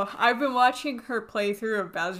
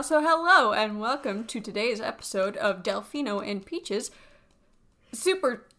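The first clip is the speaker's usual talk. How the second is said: show host intro; 6 dB louder, slower than usual. Acted. Show opening.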